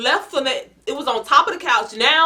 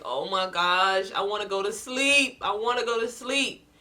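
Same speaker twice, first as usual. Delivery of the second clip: weary whine; 4 semitones lower, 7 dB quieter than usual. Retold or imitated. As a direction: sigh it, desperate for bed. Tired, lizard won't move.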